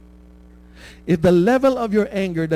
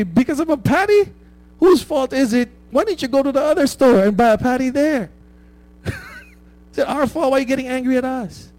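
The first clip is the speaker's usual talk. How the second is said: exasperated; 7 semitones higher than usual. Retold or imitated. Exasperated retort to angry man.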